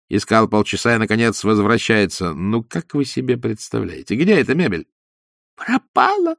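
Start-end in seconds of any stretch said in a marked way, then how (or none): 5.6-6.4 very high squeaking cry. A narrator's reading. Vorobyaninov squeaks in fear that the furniture is gone. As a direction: squeak out the dread. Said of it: "пискнул Воробьянинов".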